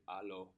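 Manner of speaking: "Allo" with no h at the start; foreign phone greeting. Shown as a demonstration. Teacher showing how they answer the phone, "Allo" without the h. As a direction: say "Allo" with an open first vowel and silent h. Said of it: "when they answer the phone, they say".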